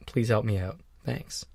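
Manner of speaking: ordinary delivery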